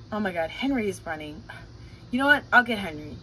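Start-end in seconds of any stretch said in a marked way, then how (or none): none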